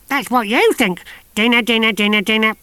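put-on high, loud old crone singsong; mad old woman. A narrator's reading. Mrs Tachyon calling to the soldiers, high singsong. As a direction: chirp it like a batty old lady.

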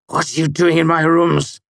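loud, high-pitched indignant demand; angry demand. A narrator's reading. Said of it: "he demanded".

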